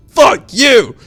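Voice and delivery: very high, very loud shout; body yelling back. Retold or imitated. Body curses back at brain, very high and loud. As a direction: scream it even harder. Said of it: "my body's like".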